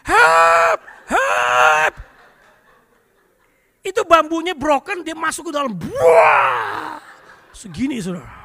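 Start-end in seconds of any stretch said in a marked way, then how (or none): 0.1-1.9 sudden very loud yell of someone in trouble; full-volume mimicked holler; shouted cry for help. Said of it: "tiba-tiba dari belakang dia teriak". 5.8-7.0 big explosive splash noise; booming mimicked plunge; loud imitated crash.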